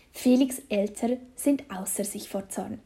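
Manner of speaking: usual speaking voice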